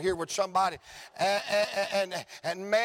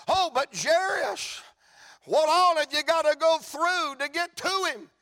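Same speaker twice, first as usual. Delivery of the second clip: pleading bystander; 7 semitones higher, 3 dB louder than usual. Acted. Bystander presses Jairus.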